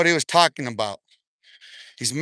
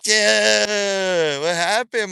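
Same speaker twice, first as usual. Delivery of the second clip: long drawn-out, higher mock "yeah"; mocking imitation; 3 semitones higher, much slower than usual. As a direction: imitate him, stretching it out high. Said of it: "This motherfucker was like".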